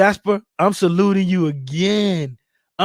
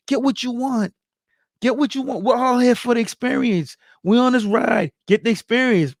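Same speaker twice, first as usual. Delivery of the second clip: ordinary talk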